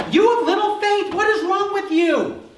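high, loud exasperated shout; acted outburst of frustration; frustrated scolding. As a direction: chew out the disciples at full voice. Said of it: "Jesus is kind of frustrated with them".

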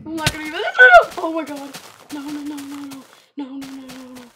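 terrified gamer screaming at a monster; panicked screaming. Real, not acted. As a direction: shriek in real panic as it attacks. Panicked fight with a mob.

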